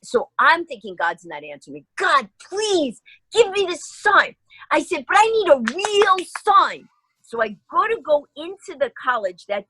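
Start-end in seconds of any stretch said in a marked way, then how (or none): 2.0-4.3 high, slow, imploring plea to God; pleading prayer. Retold or imitated. Praying to God for a sign. 5.1-6.9 high, slow, imploring plea to God; pleading prayer. Retold or imitated. Praying to God for a sign.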